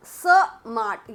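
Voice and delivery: स, then mart, slowly as two separate pieces; split into parts. Shown as a demonstration. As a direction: break स off from mart, stressing स. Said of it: "यानी कि पहला part".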